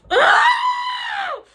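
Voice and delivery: high, loud anguished wails; retold anguished howling. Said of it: "then I got louder".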